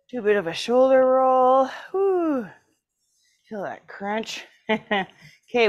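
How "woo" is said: excited woo. Real, not acted. After shoulder roll, relief.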